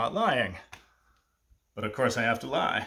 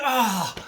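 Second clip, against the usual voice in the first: frustrated groan; 9 semitones higher, 3 dB louder than usual. Retold or imitated. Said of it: "So frustrated".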